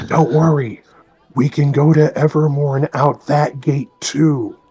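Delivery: stage whisper, half voiced; loud conspiratorial whisper. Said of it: "I whisper loudly".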